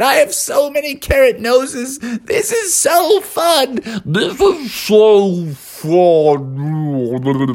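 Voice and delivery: high, slowing, fading glee; happy melting snowman. A narrator's reading. Snowman melting among carrots, slowing glee. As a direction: stretch it out as he melts away.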